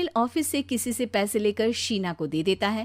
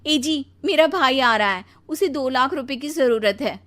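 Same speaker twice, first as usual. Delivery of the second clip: lightly voiced wife, pitched up; demanding wife; 5 semitones higher, 5 dB louder than usual. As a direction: press your husband a bit louder and higher. पत्नी पति से पैसे माँगती है. (थोड़ी ऊँची आवाज़ में माँगती पत्नी)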